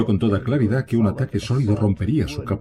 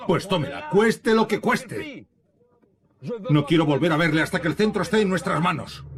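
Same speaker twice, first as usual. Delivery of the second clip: angry command; 8 semitones higher than usual. Acted. Napoleon ordering Ney to take farm.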